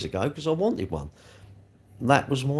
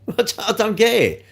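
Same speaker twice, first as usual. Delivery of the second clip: laughing, pitch up and louder; laughing disbelief; 6 semitones higher, 5 dB louder than usual. Real, not acted. Vlogger laughing at car complainers, laughing.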